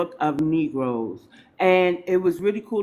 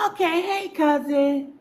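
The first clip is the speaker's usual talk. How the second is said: pitched-up sing-song hey cousin; playful greeting; 10 semitones higher than usual. Retold or imitated. Host replaying her reply to Jerika, high playful greeting. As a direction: greet her like long-lost family, high and teasing. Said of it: "And I said".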